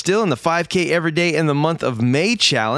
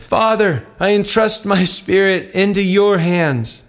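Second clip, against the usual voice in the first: a touch higher, slow, lifted cry; mild shout; 7 semitones higher than usual. A narrator's reading. Jesus on the cross calls out to the Father, a mild slow cry. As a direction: call out to heaven, slow and raised but understated. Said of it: "Then Jesus shouted".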